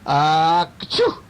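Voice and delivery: hammed-up "ah" bursting into a sneeze; acted sneeze. A narrator's reading. Storyteller makes the noise heard at night, acted sneeze. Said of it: "she heard another noise".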